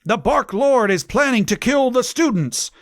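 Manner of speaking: high-pitched hammed-up warning; theatrical yell. A narrator's reading. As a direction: proclaim it high, as a mock yell. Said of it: "yelled Cornelia Fudge".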